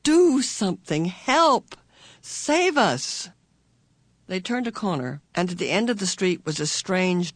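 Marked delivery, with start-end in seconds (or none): 0.0-3.3 high, loud desperate plea; desperate imploring. A narrator's reading. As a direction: beg him frantically for help. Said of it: "Meg implored her father silently".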